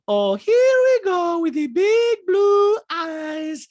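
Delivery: loud, high, drawn-out mockery; Diana. Diana mocking Sutton's eyes, loud high slow impression. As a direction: mimic her up high, stretching every word. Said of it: "And Diana's like".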